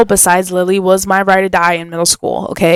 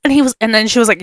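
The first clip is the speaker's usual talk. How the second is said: normal talking voice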